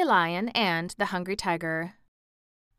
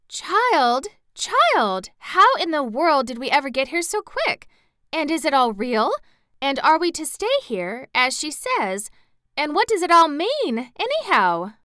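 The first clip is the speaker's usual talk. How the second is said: put-on higher, louder, astonished old farm wife; a flustered woman full of questions; bewildered old aunt; 8 semitones higher, 6 dB louder than usual. A narrator's reading. Aunt Em questioning Dorothy in the corridor, bewildered.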